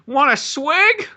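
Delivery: high, drawn-out comic offer; mock tempting offer. Jokingly playing Alan offering dino pee, mock tempting. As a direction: stretch it out high and teasing.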